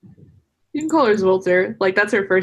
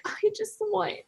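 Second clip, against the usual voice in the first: soft wistful character voice; 10 semitones higher, 8 dB quieter than usual. Retold or imitated. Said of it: "it's very, like".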